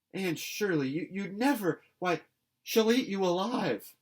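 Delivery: high, loud shocked cry; aghast. A narrator's reading. Diana horrified that Anne will face the aunt, loud alarm. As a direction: gasp it out in horror.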